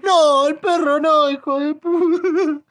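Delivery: very high, loud, slow howl; hammed-up crying over the dog; loud, high, slow fake weeping; mock sobbing wail. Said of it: "que yo lo que quieren es que esté como".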